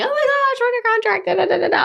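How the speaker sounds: high mock squeal of news; mock excited squeal. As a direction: gush like a giddy newcomer. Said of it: "She's texting me".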